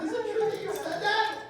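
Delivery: put-on a high little-girl question. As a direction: squeak it out like a small girl. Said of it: "She was like".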